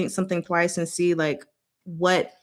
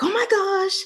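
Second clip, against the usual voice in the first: retold startled gasp; 12 semitones higher than usual. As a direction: act out an alarmed reaction, pitched way up. Retold startled reaction on seeing something.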